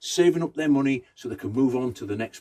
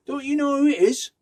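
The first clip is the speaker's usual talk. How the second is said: high, louder disbelieving question; incredulous townsman; 13 semitones higher, 4 dB louder than usual. Acted. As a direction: sound amazed they don't know.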